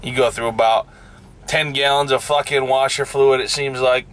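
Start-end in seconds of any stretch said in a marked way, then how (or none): none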